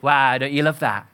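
ordinary talk

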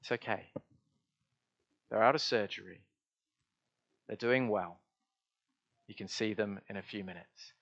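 soft, low, reassuring nurse's words; gentle reassurance. Retold or imitated. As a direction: break good news gently to a worried relative. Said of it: "they just very gently say".

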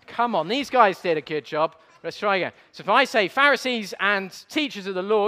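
usual speaking voice

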